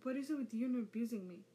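loud, fast, whiny complaint; whining. Acted. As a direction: whine about the slap. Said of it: "Sasuke whined".

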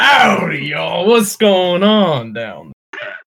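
high, booming mock-Southern holler; loud Southern howdy. Southern guy barging back into the room, loud drawl. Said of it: "Comes back in with a loud".

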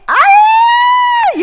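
shrill surprised ay, very high; high-pitched squeal. Real, not acted. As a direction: squeal loud and high in shock. Squeal at the idea.

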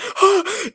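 high, loud fake gasp; mock gasp. Mocking her reaction, a high, loud fake gasp. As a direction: gasp like she's horrified. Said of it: "she's like".

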